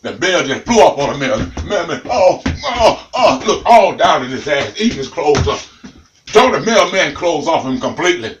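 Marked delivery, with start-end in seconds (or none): none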